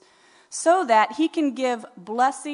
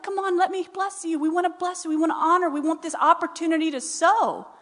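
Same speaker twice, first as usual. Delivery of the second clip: higher, urgent acted plea; eager pleading; 6 semitones higher than usual. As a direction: plead insistently, as if begging to give. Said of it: "They were pleading".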